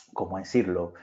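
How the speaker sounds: normal talking voice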